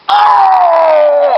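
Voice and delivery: long, loud high-pitched yell; howl of pain. Acted. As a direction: yell out a drawn-out howl of agony. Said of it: "howling in pain".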